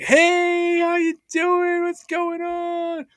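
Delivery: mock people who greet you too eagerly; fake over-friendly greeting. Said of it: "I can't stand that when people are like".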